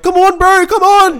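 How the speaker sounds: high, loud urging; urging shout. Retold or imitated. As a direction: cheer him on loudly. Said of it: "the Maori dude's just going".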